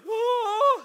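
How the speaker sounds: very high, loud drawn-out "whoa"; big hammed-up cry; acted loud wail. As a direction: wail it out at the top of your range. Acting out the king's reaction.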